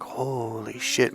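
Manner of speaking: hushed, drawn-out mock amazement; stunned realization. Client finally understanding his coach, stunned. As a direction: let it out slowly and softly.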